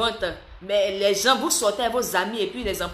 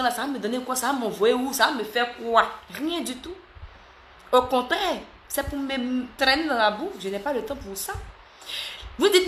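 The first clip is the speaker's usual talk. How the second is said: ordinary talk, nothing marked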